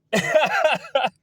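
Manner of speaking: high, loud cackling laugh; excited high-pitched laugh; real burst of laughter. Real, not acted. Speaker bursts out laughing mid-rant.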